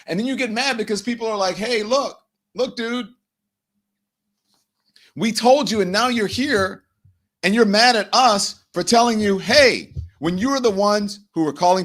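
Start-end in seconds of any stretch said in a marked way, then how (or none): 1.5-9.9 higher, louder scolding, mock exasperation; hammed-up neighbours saying we warned you; exasperated told-you-so. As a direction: throw it back at him, fed up. People scolding the complainer.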